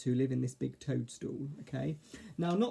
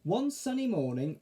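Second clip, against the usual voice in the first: ordinary delivery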